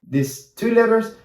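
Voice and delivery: normal talking voice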